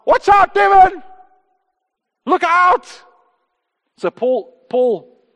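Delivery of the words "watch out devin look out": loud, high yell of alarm; urgent warning shout to a friend in danger. Retold or imitated. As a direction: scream it to stop someone stepping into traffic. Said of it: "And someone shouted".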